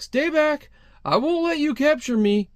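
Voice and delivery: high-pitched acted panic; hammed-up cornered man warning someone off; frantic, zero chill. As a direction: push it up high and agitated. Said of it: "the dude has zero chill about it".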